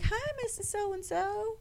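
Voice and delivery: high, soft and syrupy polite greeting; sweet customer-service voice. Retold or imitated. To the old lady at inspection, high sugary politeness. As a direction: put on the sugary visitor voice. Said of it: "I'm like".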